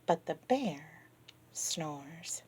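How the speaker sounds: lively read-aloud, rising and falling; children's story reading. Acted. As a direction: read to the kids with wonder. Reading picture book to kids.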